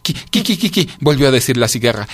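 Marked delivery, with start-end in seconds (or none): 0.0-0.9 high, squeaked syllables; stuck stammering on one sound; cold creature's pitched-up stutter; shivering stutter. A narrator's reading.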